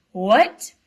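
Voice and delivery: loud, sudden rising yelp; shocked what. Acted. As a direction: blurt it in disbelief. Fred reacts to Percy news.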